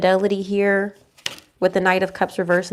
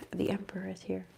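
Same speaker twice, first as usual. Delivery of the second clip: breathy hushed whisper at the cards; 15 dB quieter than usual. Real, not acted. Tarot reader revealing a card, whispered.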